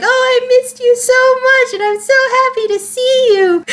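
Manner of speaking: put-on very high-pitched, gushing girly squeal; Brock's girly voice. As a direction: put on a sugary girly falsetto. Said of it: "in a very high-pitched girly voice".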